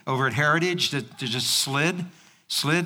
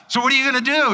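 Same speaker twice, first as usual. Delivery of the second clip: passerby voice; 7 semitones higher, 4 dB louder, much faster than usual. Retold or imitated. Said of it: "he asked me".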